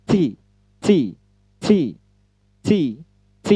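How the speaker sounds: "T" with a hissing burst of air; tongue behind upper teeth for a puffed "T"; aspirated English T. Shown as a demonstration. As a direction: push trapped air out on "T". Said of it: "lalu ucapkan".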